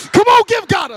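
high, loud preacher's holler; mock rallying shout. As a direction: rouse the church with a joking shout. Mock rousing the congregation.

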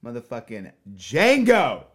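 loud, excited shout of the title. Real, not acted. Cohost excitedly shouts the movie name, loud. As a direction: belt out the title with glee.

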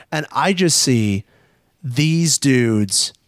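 ordinary talk, nothing marked

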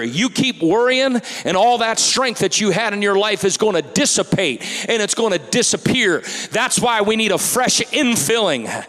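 loud, high preaching at full force; fiery sermon delivery. Acted. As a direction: preach it out to the congregation.

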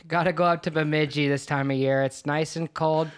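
Minnesotan praise breaking into a laugh; Minnesota accent. Pretend. Guest joking about Bemidji, Minnesota accent. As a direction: gush about the cold like a local, laughing. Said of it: "where did you get that accent from".

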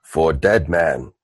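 deep, slow, solemn brute; Boom Boom toast. Acted. Boom Boom toasts the dead man, low and slow. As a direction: repeat the toast low and drawn out. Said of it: "every time one is handed, he goes".

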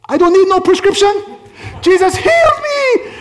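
very high, loud imitated woman's cry; triumphant shout. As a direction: shriek the healing testimony at full force. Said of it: "then she goes like this".

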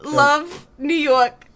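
put-on very slow, drawn-out Brooklyn accent; signing off as Brooklyn [name] in a slow New York accent. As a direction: stretch it out in broad New York. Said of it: "I can't do this accent".

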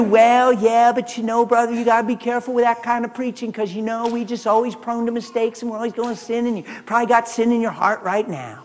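hint of a nagging naysayer; mock cautious critic. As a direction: imitate a fretful churchgoer. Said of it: "people talk like that".

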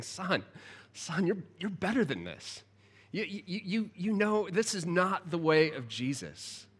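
soft, tender, slow rebuke; gentle fatherly voice. Retold or imitated. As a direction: lovingly correct a son. God voiced gently correcting.

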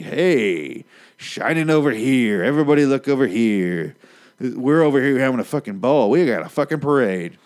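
loud, high acted holler to a crowd; exuberant calling out. Rainbow flag showing off its parade, exuberant calling out. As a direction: call everyone over to the party, drawing out the words.

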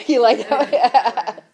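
laughing out the question, loud and slow; teasing through a real laugh. Real, not acted. Pastor laughing at a listener's reaction, laughing.

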